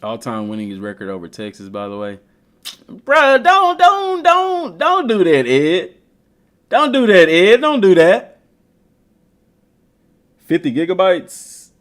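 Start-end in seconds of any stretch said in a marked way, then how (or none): 2.9-8.4 high, loud begging don't; half-joking high-pitched plea to Ed; pleading protest. Real, not acted. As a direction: beg him to stop, voice cracking high. Pleading with friend Ed.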